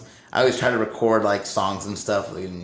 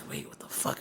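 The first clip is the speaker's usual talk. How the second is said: hushed, much softer retold disbelief; alarmed whisper; 4 semitones higher, 14 dB quieter than usual. Bandmate realizes fire is beside him, alarmed whisper. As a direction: whisper it as a worried thought.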